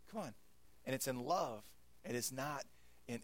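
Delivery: usual speaking voice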